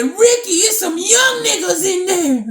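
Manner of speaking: high, drawn-out imitated wail; mock crying scream. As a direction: wail it to the brother in exaggerated horror. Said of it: "he cried".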